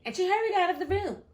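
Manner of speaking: usual speaking voice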